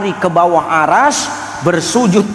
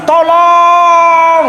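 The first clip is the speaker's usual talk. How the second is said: long, high, loud cry for help; drawn-out theatrical plea from two screaming people; mock scream for help; 10 semitones higher, 5 dB louder than usual. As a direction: holler it out long and high, hammed-up. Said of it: "Menjerit dua orang ini".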